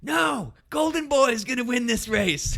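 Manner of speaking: very high, loud heckling holler; imitating sister's yell. Sister yelling back for her brother at the race, imitated high loud yell. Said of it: "my sister yells".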